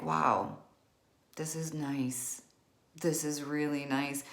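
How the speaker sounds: soft, slow, near-whispered wonder; hushed contentment. Retold or imitated. Alone on Christmas morning, hushed and content. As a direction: savor the quiet morning gently. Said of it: "I remember feeling like".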